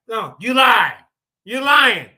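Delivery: loud, blunt accusation; just shout it flat out; blunt loud callout. Shown as a demonstration. Showing how he would answer a debater, loud and blunt. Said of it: "That's all I gotta say".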